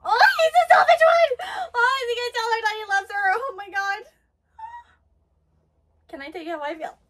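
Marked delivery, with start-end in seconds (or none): 0.0-4.0 high, loud tearful outburst easing off; overwhelmed squeal. Real, not acted. Viewer overcome as he may confess love, excited squeal. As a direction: squeal it, then rush on breathlessly.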